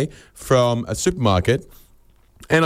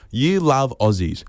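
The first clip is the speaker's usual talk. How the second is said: slow, deliberate challenge at normal pitch; pulling them up; slower than usual. Acted. Traveller pulling up a gushing foreigner, slow sceptical challenge. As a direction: repeat their words back slowly, unimpressed. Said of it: "you have to pull them up".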